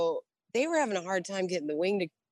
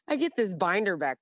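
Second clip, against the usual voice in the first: ordinary delivery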